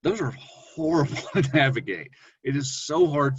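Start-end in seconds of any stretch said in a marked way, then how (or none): none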